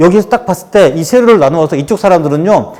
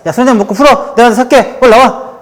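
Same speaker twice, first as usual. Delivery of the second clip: high-pitched, insistent buddy invitation; hearty drinking buddy; 7 semitones higher, 3 dB louder than usual. Acted. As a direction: act out coaxing a pal out for drinks. Acts men making up over drinks.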